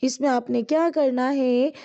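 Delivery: ordinary talk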